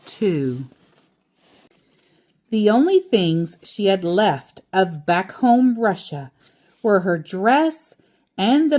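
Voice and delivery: steady storybook read-aloud; reading to a child. A narrator's reading. Parent reading The Keeping Quilt to child, storybook voice. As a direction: read it gently to your child at bedtime.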